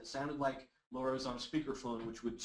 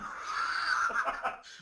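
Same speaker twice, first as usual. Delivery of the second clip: short chuckle; amused burst of laughter; 4 dB louder than usual. Real, not acted. Chuckles at student's answer.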